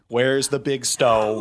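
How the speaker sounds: slow, loud mock demand; mock calling out. Host pretending he must kill Jeffrey, mock calling out. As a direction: drag it out loudly, as a joke. Jokingly calls for stone to kill.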